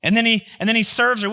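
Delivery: normal talking voice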